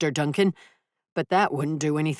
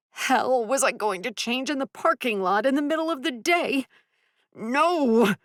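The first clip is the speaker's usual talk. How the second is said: angry; 10 semitones higher than usual. Acted. Furious inner outburst.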